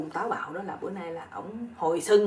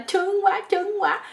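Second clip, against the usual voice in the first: loud, very high-pitched mock female cry; odd crying out; 12 semitones higher, 6 dB louder, slower than usual. Young woman crying out in bed, a comically odd high cry. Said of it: "con nhỏ này nó la".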